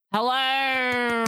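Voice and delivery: long drawn-out, high hello; excited greeting. Real, not acted. Host welcoming the award-winning guest, excited greeting.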